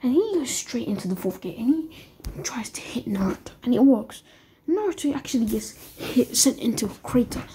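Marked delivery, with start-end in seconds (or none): none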